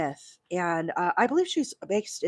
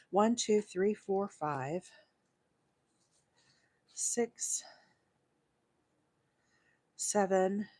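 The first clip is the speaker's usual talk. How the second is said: counting under breath; 5 dB quieter, slower than usual. Real, not acted. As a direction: count fading into a muttered near-whisper. Counting stitches while working.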